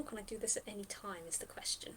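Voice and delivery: unvoiced trailing aside; whispered aside. Real, not acted. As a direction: mutter it to yourself. Muttering rules question.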